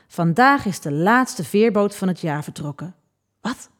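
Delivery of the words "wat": soft, barely voiced "what"; whispered shock. A narrator's reading. Girl hearing the ferry is gone, whispering in shock. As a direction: breathe the word out in disbelief. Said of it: "fluisterde ik".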